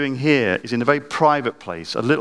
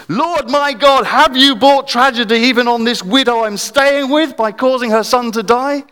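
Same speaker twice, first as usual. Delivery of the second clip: high, loud anguished cry; crying out to God; 12 semitones higher, 6 dB louder than usual. Retold or imitated. Elijah crying out to God over the dead boy, loud and anguished. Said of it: "he cried out".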